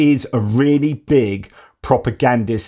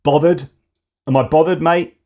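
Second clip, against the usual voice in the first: higher, cheeky dismissive imitation; mock Macron shrugging it off; dismissive Macron mockery; 5 semitones higher than usual. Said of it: "And Macron's like".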